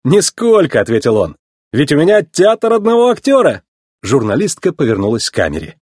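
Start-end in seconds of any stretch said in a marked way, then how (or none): 0.0-0.8 higher, punchy cocky answer; brash young racer's confident reply. A narrator's reading. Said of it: "одарил её дерзкой улыбкой". 1.7-3.6 high, brash boast; proud young hotshot showing off; cocky boast. A narrator's reading. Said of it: "одарил её дерзкой улыбкой".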